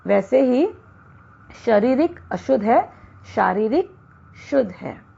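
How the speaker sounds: usual speaking voice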